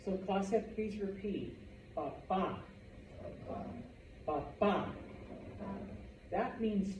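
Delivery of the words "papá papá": "Papa" with the second A stressed; Spanish "Papa", pa-PA; stress on last syllable. Shown as a demonstration. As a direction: model "Papa" for a class, punching the final A. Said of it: "emphasis on that vowel".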